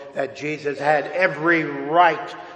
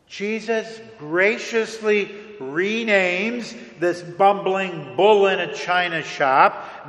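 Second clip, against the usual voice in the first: ordinary talk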